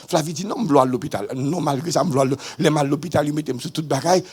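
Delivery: ordinary talk, nothing marked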